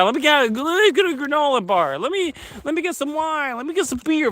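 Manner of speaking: high sarcastic sing-song; mock his own naive hopes in a high whine. Mocking his own plans for the trip in a high sarcastic voice.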